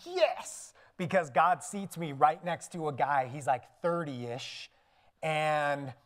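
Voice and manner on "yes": high, gleeful yes; a celebratory squeal, kept light in volume; high-pitched little cheer; delighted yes. Retold or imitated. Speaker acts out his delighted reaction.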